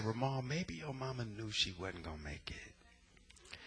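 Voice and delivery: gentle whisper. Retold or imitated. Therapist comforting him about his mama, whispered. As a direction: breathe it to him tenderly.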